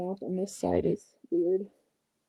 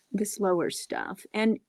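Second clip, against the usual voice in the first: usual speaking voice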